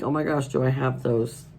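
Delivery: ordinary delivery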